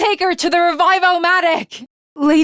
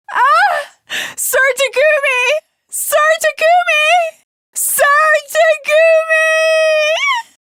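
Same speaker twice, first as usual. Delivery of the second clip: anguished scream; 11 semitones higher, slower than usual. Acted. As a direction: scream his name as he is lost. Screaming as he falls.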